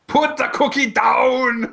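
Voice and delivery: high, slow, strained Arnold yell; Schwarzenegger quote. Retold or imitated. Mocking his famous line with a strained high yell. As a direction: bark it like a hammed-up Arnold. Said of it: "Keep going, 'cause it's".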